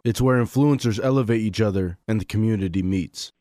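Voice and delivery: steady upbeat sponsor read. Acted. Host reading the sponsor message to listeners, ad read. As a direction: read the sponsor spot cleanly.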